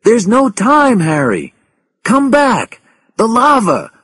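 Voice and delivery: loud, very high plea; alarmed cry over the radio; urgent warning. A narrator's reading. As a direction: shout him back from danger.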